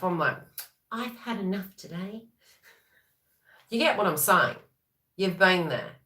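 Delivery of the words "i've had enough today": soft, drained, fed up; half-joking, worn out by the day; mock weary sigh. Mocking herself for a long day, softly fed up. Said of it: "I'm mocking myself".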